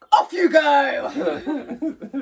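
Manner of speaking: loud, laughing and drawn out; laughing send-off. Real, not acted. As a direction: cheer them off through laughter. Sending parents off, laughing.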